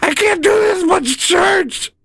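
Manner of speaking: put-on high, slow whining kid shouts; overwhelmed kids. Kids after seven hours in church, high slow whining shouts. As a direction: wail it like a fed-up child. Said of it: "They're like".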